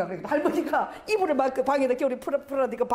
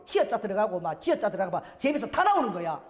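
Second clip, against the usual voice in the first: ordinary talk, nothing marked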